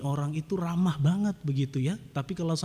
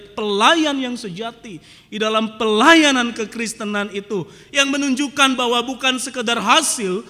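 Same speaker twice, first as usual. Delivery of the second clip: loud, driving sermon delivery; forceful preaching; 8 semitones higher, 10 dB louder than usual. Acted. Pastor hammering his point to the congregation, loud. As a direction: preach it out to the whole church.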